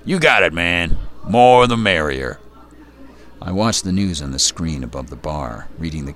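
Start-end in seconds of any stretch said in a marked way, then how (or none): 0.1-2.4 gruff bartender, grumbled loud and high; gravelly voice. A narrator's reading. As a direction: grumble it like a rough old barkeep. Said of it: "His gravelly voice".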